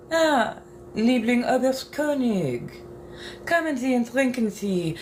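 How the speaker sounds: practicing German lines in character; German lines spoken in character; German accent attempt. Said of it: "trying to keep this accent".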